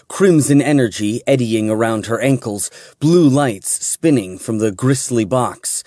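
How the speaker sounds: ordinary delivery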